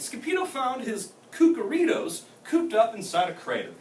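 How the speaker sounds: ordinary delivery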